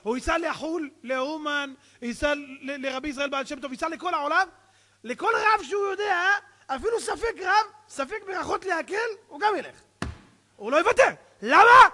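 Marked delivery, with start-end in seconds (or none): 5.0-11.9 high, loud, mounting insistence; impassioned preaching. Real, not acted. Preacher describing a man desperate for a cure, impassioned build-up. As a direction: build hard to a punched-out question.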